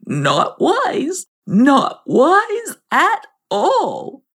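high, slow frog mutter. Acted. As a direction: mutter it, shaking your head. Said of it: "shook his head ... and muttered".

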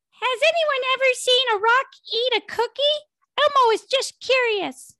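high squeaky puppet voice, quick; childlike falsetto read; Elmo voice. Acted. Reading Elmo's tweet in voice.

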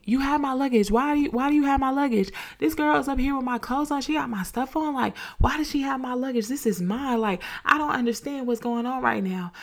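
high and urgent, as if your bag is being stolen; panicked protest at a stranger walking off with her clothes; frantic disbelief. Retold or imitated. Retold panic at thief.

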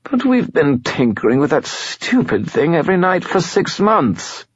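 high whiny spirit voice. A narrator's reading. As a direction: whine like a sulky skull. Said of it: "The skull's voice took on a whiny tone".

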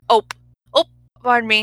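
a light Midwestern "Ope". Shown as a demonstration. Demoing the Midwestern ope to a friend. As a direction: pop out "Ope. Ope." like a Midwesterner bumping someone. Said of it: "ope" is a thing in the Midwest".

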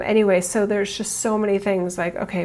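ordinary delivery

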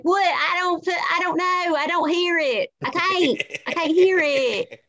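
put-on high, protesting South Carolina accent; exaggerated Southern accent. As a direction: play up your old South Carolina accent, baffled. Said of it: "You're gonna have to lose that accent".

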